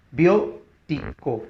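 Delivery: Biótico slowly split as BIÓ-ti-co; syllable by syllable. Shown as a demonstration. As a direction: sound out Biótico with pauses, leaning on BIÓ. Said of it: "puesto que es esdrújula". (Biótico silabeado despacio, con fuerza en BIÓ)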